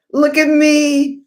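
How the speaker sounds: slow, high, loud brag; showing off the medal on camera; proud show-off. Real, not acted. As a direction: draw it out and bask in it.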